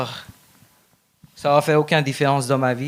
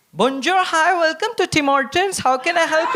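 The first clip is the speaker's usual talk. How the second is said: high, chirpy Indian English customer-service voice; Indian English accent; 11 semitones higher than usual. Shown as a demonstration. Comedian shows his Indian English accent at the counter. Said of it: "mon accent en anglais, c'est un instinct naturel".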